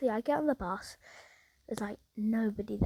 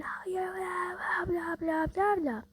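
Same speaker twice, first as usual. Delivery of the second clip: slow, higher, hammed-up blah-blah rant; mock screaming; 7 semitones higher, slower than usual. Parked man screams at the driver, mocked as higher blah-blah. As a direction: parody his endless yelling, drawn out. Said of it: "he's just screaming".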